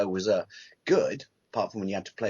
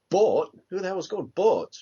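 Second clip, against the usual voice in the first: higher, louder mock bafflement; baffled question; 7 semitones higher, 4 dB louder than usual. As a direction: act out baffled disbelief. Acted Simpsons scene, baffled reader.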